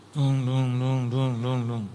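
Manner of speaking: low, repeated "dung" sounds; imitated bumping noise. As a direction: bump along on evenly repeated low syllables.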